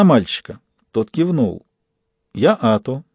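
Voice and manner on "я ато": usual speaking voice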